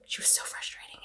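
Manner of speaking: whispered exasperation; whispered repeat. Real, not acted. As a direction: hiss it under your breath.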